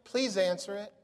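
a hint of a cute pleading caller; cute little voice. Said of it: "this cute little voice".

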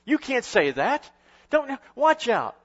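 high, slow, scolding rebuke. Retold or imitated. Preacher mimicking well-intentioned Christians scolding Jesus, mock stern. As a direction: hush Jesus sharply. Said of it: "they would say".

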